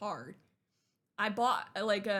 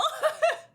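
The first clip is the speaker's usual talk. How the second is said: high, loud emotional laugh; teary laugh; 10 dB louder than usual. Real, not acted. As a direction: laugh through rising tears. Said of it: "I'm getting emotional".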